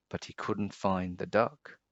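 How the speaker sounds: ordinary delivery, nothing marked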